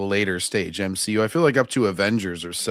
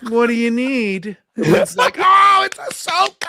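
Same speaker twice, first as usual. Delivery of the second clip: much higher, louder, slow gasping cheer; excited crowd; 7 dB louder than usual. Retold or imitated. Mocking fans reacting to a surprise cameo, high excited shout. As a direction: squeal it like a fan seeing a cameo. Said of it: "it'll be like".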